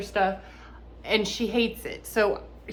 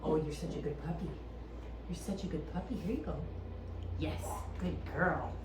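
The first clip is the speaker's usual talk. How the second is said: squeaky praising a dog; 5 semitones lower, 13 dB quieter, faster than usual. Real, not acted.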